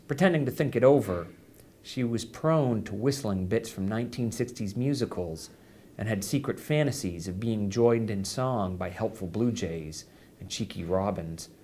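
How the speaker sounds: ordinary delivery, nothing marked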